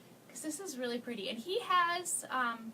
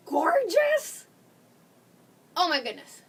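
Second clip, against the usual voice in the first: high, loud delighted squeal on gorgeous; excited squeal; 5 semitones higher, 10 dB louder, slower than usual. Real, not acted. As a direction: gush over it like you can't believe it. Showing off the transfer.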